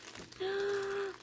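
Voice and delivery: sharp gasp of delight. Real, not acted. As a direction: gasp as the gift is revealed. Unboxing happy mail, delighted.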